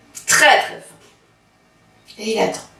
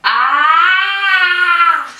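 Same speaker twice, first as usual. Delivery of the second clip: wider aah; 5 semitones higher than usual. Acted. Wolf opens wider for the rabbit, saying aah. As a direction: gape wider and cry aah. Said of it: "ouvre plus grand".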